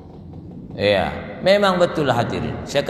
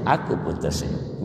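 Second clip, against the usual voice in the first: whispered gently, lower; soft whisper; 9 semitones lower, 6 dB quieter than usual. Acted. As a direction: drop into a tender hush. Prophet's words quoted tenderly. (bisikan lembut dan rendah)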